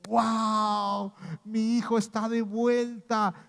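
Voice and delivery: high, loud, slow burst of joy; a father overjoyed to see his son home; joyful father. Retold or imitated. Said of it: "el Espíritu del Padre dijo".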